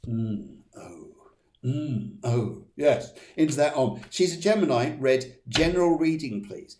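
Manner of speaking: ordinary talk, nothing marked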